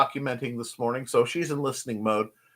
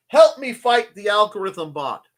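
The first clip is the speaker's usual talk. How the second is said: ordinary talk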